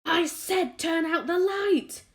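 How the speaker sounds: high, loud, slow furious yell; angry shout. A narrator's reading. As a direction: bellow it at the glowworm in a rage. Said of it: "shouted the centipede angrily".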